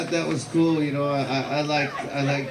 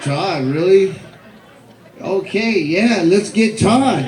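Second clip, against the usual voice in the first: excited; 6 semitones higher, 5 dB louder, slower than usual. Retold or imitated. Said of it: "I was like".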